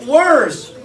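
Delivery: loud, high dramatic reveal; a booming dramatic reveal. Acted. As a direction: announce it like doom.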